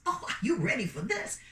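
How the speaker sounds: usual speaking voice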